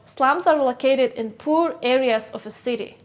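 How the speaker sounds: normal talking voice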